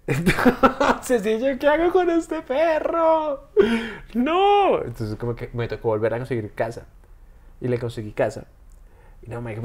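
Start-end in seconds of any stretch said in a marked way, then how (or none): 0.1-4.8 much higher, slow, laughing wail of mock despair. Telling a friend he got dumped and kept the dog, laughing despair. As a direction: cry out in comic hopelessness, laughing.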